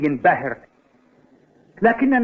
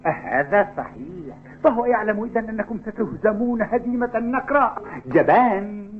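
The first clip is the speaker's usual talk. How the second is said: ordinary delivery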